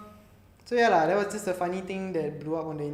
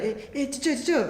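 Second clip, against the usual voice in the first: put-on Malaysian accent; 7 semitones higher, much faster than usual. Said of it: "you didn't ask teacher, like".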